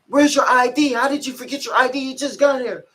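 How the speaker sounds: high-pitched, loud mock scolding; fake yell. Officer scolding inmate over missing ID, imitated yelling. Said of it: "He trying to fake yell at me and shit".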